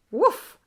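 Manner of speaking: sudden high, loud "woof"; tongue-flick sound effect. Retold or imitated. Showing viewers the woodpecker's tongue, sound effect. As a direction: punch out a quick whoosh noise.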